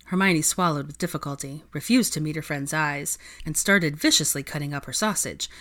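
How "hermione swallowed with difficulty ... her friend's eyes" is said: usual speaking voice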